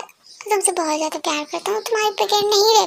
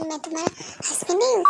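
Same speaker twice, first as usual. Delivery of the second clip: usual speaking voice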